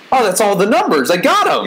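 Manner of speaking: put-on high-pitched triumphant little kid; excited four-year-old. A kindergartner looking at the numbers chart, gleefully triumphant. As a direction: play a kindergartner thrilled to have it all figured out. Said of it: "being a four-year-old kid in kindergarten".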